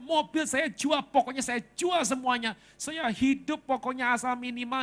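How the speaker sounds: high-pitched, pleading outburst of an imitated wife; distraught wife. Wife phones the pastor to sell everything, distraught. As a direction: play a wife at the end of her rope. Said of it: "Istrinya kaget nangis".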